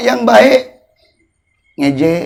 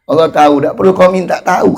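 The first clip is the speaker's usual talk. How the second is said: usual speaking voice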